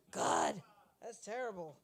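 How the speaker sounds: ordinary delivery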